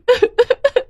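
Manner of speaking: high, exaggerated boo-hoo sobs; fake sobbing. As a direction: burst into hammed-up crying. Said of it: "Shakuntala रो पड़ी". (नकली ज़ोरदार सिसकियाँ)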